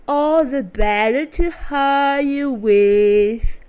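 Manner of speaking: loud, slow wolf voice; menacing grandma impression. A narrator's reading. Wolf answering the girl from the bed, loud and slow.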